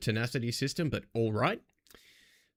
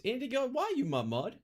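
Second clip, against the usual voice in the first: exasperated high whine; 12 semitones higher than usual. Real, not acted. Streamer whining up high at his mod. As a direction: pitch jumps way up in mock despair.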